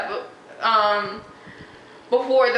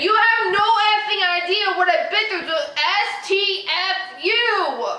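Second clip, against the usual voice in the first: angry retort; 6 semitones higher than usual. Retold or imitated. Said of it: "I'm sorry for yelling so much".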